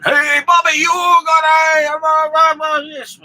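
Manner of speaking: high, drawn-out holler; mock loud yelling. Trucker imitating Boston locals calling to Bobby, high loud mock shout. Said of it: "they're just loud".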